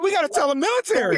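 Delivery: fast, high, alarmed; urgent panic. Retold or imitated. Kid wanting to warn the military, fast and high. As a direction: rush it like an emergency.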